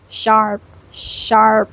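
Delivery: "Sharp" with a strong initial sh; isolated, drawn-out "sharp". Shown as a demonstration. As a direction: lean on the sh in "sharp". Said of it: "you give a sharp sh".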